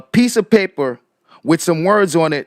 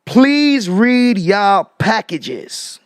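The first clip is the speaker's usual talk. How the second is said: very slow, every word of "Please read y'all packages" weighted; "Please read y'all packages" spelled out slowly and firmly; emphatic quote; 3 semitones higher, 3 dB louder, much slower than usual. Retold or imitated. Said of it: "let me just make sure we understand what Tiffany said".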